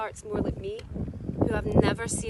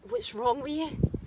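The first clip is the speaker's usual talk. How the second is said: slow, drawn-out inner accusation; a restrained scream, stretching the question; mild inner scream; 3 semitones higher, 3 dB louder than usual. Acted. An anxious mind turning on its owner, mildly screamed. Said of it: "my mind would scream".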